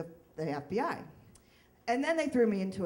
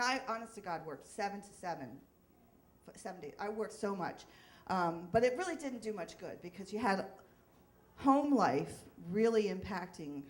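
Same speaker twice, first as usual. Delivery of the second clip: ordinary talk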